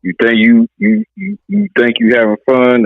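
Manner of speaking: usual speaking voice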